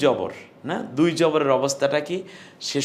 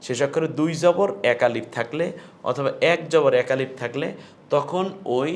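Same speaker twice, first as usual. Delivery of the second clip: ordinary talk, nothing marked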